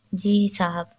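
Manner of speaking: plain reading voice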